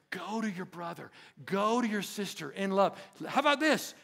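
normal talking voice

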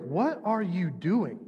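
slow, incredulous mock question; imitated disbelief. Onlookers watching him wet the altar, imitated disbelief. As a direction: stretch it out like a baffled onlooker. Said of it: "imagine people standing there like".

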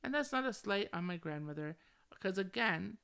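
ordinary talk, nothing marked

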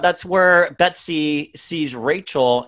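ordinary talk, nothing marked

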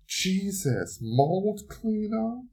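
high, slow, rising disbelief; can you believe it; stretched-out high question; incredulous. Real, not acted. Disbelief at her own accident.

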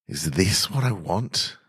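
ordinary delivery

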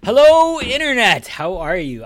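big, high announcer shout; loud show greeting. Acted. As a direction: belt out the welcome like a host. Opening the show to listeners.

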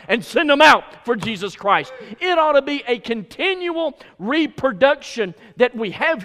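loud, rhythmic pulpit build, punching each command; full-force preaching. Real, not acted. Preacher urges the church to win people, full-force preaching. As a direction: drive each phrase home loudly.

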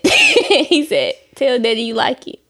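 giggles breaking through the words; barely holding in laughter; trying not to laugh. Real, not acted. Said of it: "I'm trying not to laugh".

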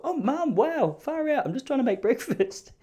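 high, taken-aback whine; mock shocked son. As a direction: act caught off guard. His own shocked reply.

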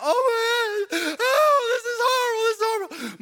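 hammed-up crying panic; freaking-out parent. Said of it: "Freaking out, freaking out".

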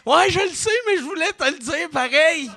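high, meek little voice; high sheepish customer. Retold or imitated. Customer pestering the tech guy, high and meek. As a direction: squeak it out like a pest who knows he's wrong.

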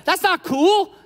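high, loud indignant complaint. Retold or imitated. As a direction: whine it like a slighted sister. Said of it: "she's like".

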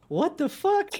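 sudden high incredulous cry; shocked surprise. Retold or imitated. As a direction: yelp it in disbelief. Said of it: "I was just like".

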